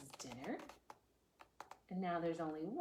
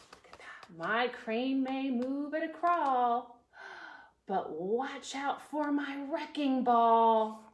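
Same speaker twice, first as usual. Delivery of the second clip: slow, proud, louder builder; Mister Gilly; 4 semitones higher, 7 dB louder than usual. Acted. Demolition man bragging to the reader, lightly voiced. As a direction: boom it out like a proud crane man. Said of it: "He says".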